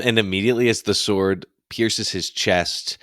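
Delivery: ordinary talk, nothing marked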